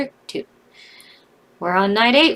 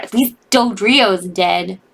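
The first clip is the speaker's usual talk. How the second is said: ordinary talk, nothing marked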